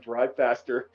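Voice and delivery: soft, low-pitched retold command; deadpan mock urgency, voice dropped; low getaway order. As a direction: murmur it to the getaway driver.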